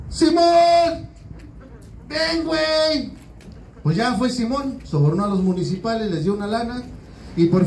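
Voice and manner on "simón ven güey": very high, slow pleading call; desperate call. Retold or imitated. Jesus calling Simon again, much higher and slower. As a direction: wail it out from the cross. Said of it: "postrado en su cruz dijo".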